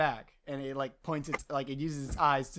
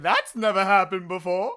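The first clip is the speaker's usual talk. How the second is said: lightly voiced talking machine, louder; sentient vending machine; 2 semitones higher, 8 dB louder than usual. Vending machine reacts to the jumping bag, lightly voiced character. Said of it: "sentient vending machine's like".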